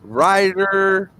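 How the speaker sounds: slow, drawn-out outro announcement; sign-off. Acted. Wrapping up the podcast, drawn-out announcer sign-off. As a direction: announce the show's top five like a host. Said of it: "closing the episode".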